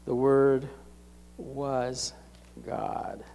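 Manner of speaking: word by word with solemn pauses; low, hushed "God" after a pause on "was"; very clear statement. Retold or imitated. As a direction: set each word apart, letting "God" drop quietly. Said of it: "he says very clearly".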